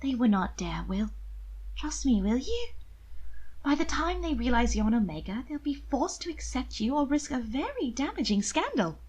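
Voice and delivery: high, gentle sister reassuring; gentle coaxing sister's voice; gently. A narrator's reading. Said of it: "Very gently she said".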